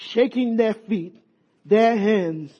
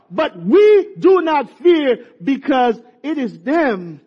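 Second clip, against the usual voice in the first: slow emphatic; 3 semitones higher, 5 dB louder, slower than usual. Real, not acted. Preacher slows after climax.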